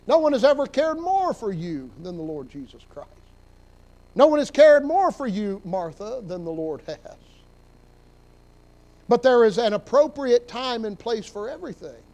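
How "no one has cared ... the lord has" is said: usual speaking voice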